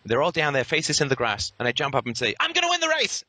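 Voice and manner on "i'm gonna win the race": much higher, triumphant playful cry; gleeful taunt. Retold or imitated. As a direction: crow it like a cheeky kid winning. Jumping up to race kids.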